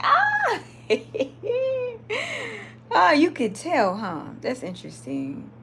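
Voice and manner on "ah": real shriek of surprised laughter; delighted squeal and laugh. Real, not acted. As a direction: squeal then burst out laughing. Viewer guesses her accent.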